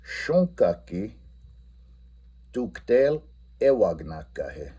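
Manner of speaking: slow, careful Lakota sentence with stress on tuktel; teacher modelling a Lakota question slowly; slow Lakota model phrase. Shown as a demonstration. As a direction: say each Lakota word slowly and clearly. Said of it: "I'm going to say".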